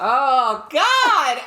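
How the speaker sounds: loud, high laughing "oh God"; exasperated laughing groan; laughing exclamation. Real, not acted. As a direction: burst out laughing. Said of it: "laughing exclamation".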